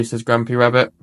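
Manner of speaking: ordinary delivery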